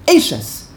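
Eshes stressed on the first syllable. Shown as a demonstration. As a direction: punch the E of Eshes, let the end fall. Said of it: "in this case is the first syllable".

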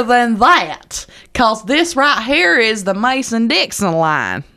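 high, slow hammed-up Southern drawl; exaggerated Southern twang. Teacher pointing at the map for students in thick twang. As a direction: lay the twang on as thick as it goes. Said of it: "the most exaggerated I can make it".

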